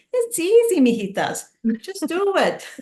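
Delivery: high-pitched take on mom cheering on her daughters; imitating mom. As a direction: play mom in limited English, pitched right up. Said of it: "my mom wouldn't speak too much English".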